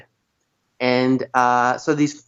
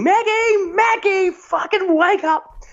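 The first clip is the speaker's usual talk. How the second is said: high, drawn-out mock scream; retold screaming; 14 semitones higher, slower than usual. Stuck storyteller yelling to wake Maggie, high retold scream. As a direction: re-enact screaming for help, pitched way up. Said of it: "I started screaming".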